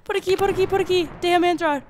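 high, loud hurried directions; urgent calling. Real, not acted. Gamer racing to a door, urgent high calls. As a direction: push toward the door urgently. Running for the exit.

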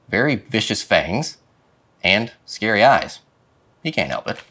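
ordinary delivery, nothing marked

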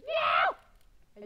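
high, loud panicked yells; panicked screaming. Real, not acted. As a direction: scream as you fall. Falling to death in game.

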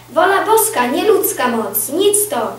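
loud declaration. A narrator's reading. Commander reacting to news of a defeat, loud declaration. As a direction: proclaim it firmly.

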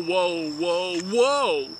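ordinary talk

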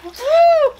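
high, loud cheer; excited whoop. Real, not acted. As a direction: whoop with delight. Cheering after the dunk.

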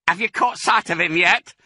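high-pitched call across a distance; calling back. A narrator's reading. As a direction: call it over to the lookout. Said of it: "Bean called back".

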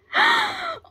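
very high, sudden intake; shocked gasp. Real, not acted. Fan spots a different card, high shocked gasp. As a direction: gasp sharply in happy shock.

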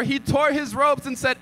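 ordinary talk, nothing marked

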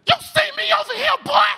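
very high, loud inmate holler; threatening yell. Retold or imitated. Inmate to new arrival, high loud challenge. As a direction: holler the challenge across the pod. Inmate yelling from his cell.

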